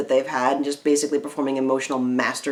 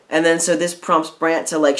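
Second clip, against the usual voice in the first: usual speaking voice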